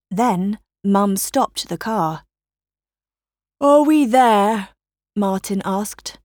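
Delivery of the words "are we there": put-on high, loud child's question; impatient child. A narrator's reading. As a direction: pipe up like an impatient boy in the car. Boy asks from back seat.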